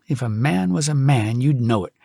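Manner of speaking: ordinary delivery, nothing marked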